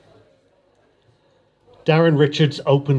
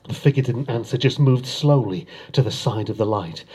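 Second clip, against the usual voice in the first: usual speaking voice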